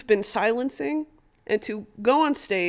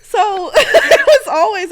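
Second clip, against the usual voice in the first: laughing; 12 semitones higher, 10 dB louder, slower than usual. Real, not acted.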